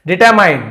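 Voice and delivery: Determine called out high and loud; teacher announcing determine loud and high; loud model word. Shown as a demonstration. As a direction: present determine loudly with a falling tune. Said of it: "determine लिखा है".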